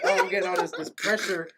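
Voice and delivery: laughing complaint. Retold or imitated. Teen complaining about family pressure.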